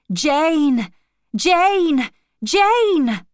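a voice crying her name through the night; distant cry. A narrator's reading. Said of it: "I heard a voice cry".

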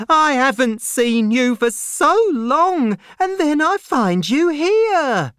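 high, loud, overcome outpouring; crying out. A narrator's reading. Miss Pross reunited with her long-lost brother, crying out high and loud. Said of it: "cried Miss Pross".

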